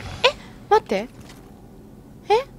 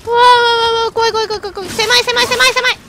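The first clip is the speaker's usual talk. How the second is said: scared squeals; 7 semitones higher, 3 dB louder, faster than usual. Real, not acted. Cramped scary passage in game.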